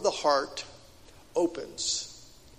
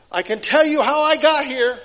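emphatic; 7 semitones higher, 8 dB louder than usual. Acted. Preacher's emphatic testimony.

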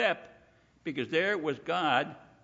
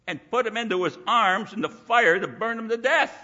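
louder, eager sightseer imitation with swinging pitch; mock enthusiasm; 5 semitones higher, 6 dB louder than usual. Preacher mimics Israelites picking out new gods to worship, mock-excited. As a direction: play an easily impressed crowd.

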